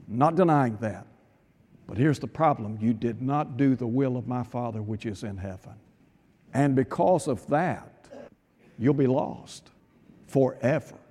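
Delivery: the Lord answering the pleading servant, low and stern; stern Lord's reply. Acted.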